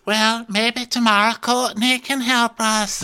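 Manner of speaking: high, slow put-on voice; mock-wistful falsetto; hopeful crewmate. Crewmate hoping for help tomorrow, high slow mock wistfulness. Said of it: "she goes".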